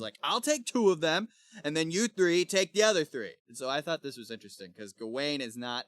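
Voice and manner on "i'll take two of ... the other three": higher, louder confident orders; bold Kay. Retold or imitated. Sir Kay divides up five enemies, bold orders. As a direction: lay out the plan boldly, pitched up. Acting Kay's bold plan.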